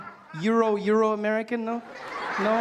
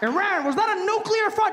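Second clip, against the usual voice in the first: America voice; 11 semitones higher, 4 dB louder than usual. Pretend. America accuses Iran.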